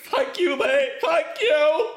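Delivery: high, loud, hammed-up sobbing thanks; mock tearful gratitude. Friend thanks comedian for booking flight home, mock tearful wail. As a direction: wail your thanks, imitating a man in tears. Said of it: "burst into tears".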